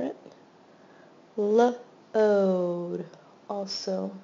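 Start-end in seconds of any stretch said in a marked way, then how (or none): 1.4-3.1 loud and segmented; sounding out load. Shown as a demonstration. Teacher sounding out a word for students, loud and segmented. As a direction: split load into L and ode, loud. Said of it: "two vowels in the middle pattern".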